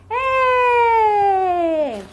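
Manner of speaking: high happy cheer for toddlers; big high celebrating yelp; cheery cheer. Shown as a demonstration. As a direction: cheer high and bright for the kids. Cheer at song end.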